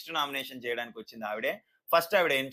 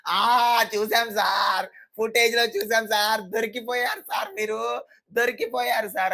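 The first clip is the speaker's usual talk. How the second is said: much higher, louder teasing gotcha voice; [name]'s mock imitation; 7 semitones higher, 6 dB louder than usual. [name] mocking Harish with a high, loud gotcha voice. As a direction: gleefully catch him out, sir. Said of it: "అని ఇమ్మాన్యుయల్ ఆయనని imitate చేయడం".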